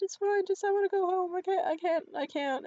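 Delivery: high, loud tearful protest, re-enacted; choked up. Woman refusing to explain to her date, acted choked up. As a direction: plead to get away, hammed-up and choked. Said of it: "And I just choked up".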